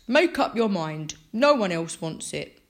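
loud, smug villain voice; Mister Bragg. A narrator's reading. Mister Bragg pressing Franklin's mum, smug. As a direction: smirk it out loudly. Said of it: "Mister Bragg smirked".

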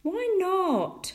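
high, drawn-out whine; childish protest. A narrator's reading. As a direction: protest as a thwarted small boy. Tom protests mother's refusal.

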